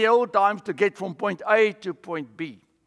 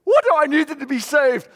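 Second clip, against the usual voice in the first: much higher and louder, gushing; starstruck fan; 5 semitones higher, 4 dB louder, slower than usual. Acted. Fan runs up to Jesus, gushing and starstruck. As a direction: blurt it out like meeting a celebrity. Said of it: "I can't even say this properly".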